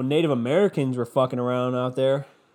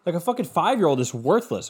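normal talking voice

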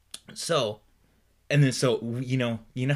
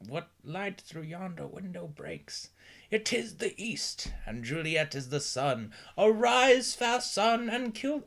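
high, slow theatrical recital; dramatic Shakespeare voice; 8 semitones higher, slower than usual. Shown as a demonstration. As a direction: declaim it like a hammy Romeo. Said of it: "I didn't say it in this voice".

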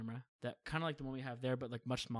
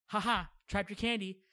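teasing gloat; 6 semitones higher, 6 dB louder than usual. Retold or imitated. Aunt teasing small child in story.

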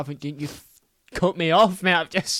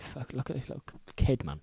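muttered aside; 3 semitones lower, 12 dB quieter than usual. Retold or imitated. Voicing actors' reaction.

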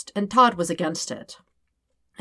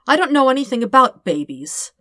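nervous; 7 semitones higher, 5 dB louder than usual. Acted. Dirk nervous about baby.

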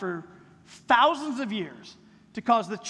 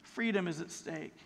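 hushed warning; 5 semitones lower, 9 dB quieter than usual. Real, not acted. Repeated grave warning.

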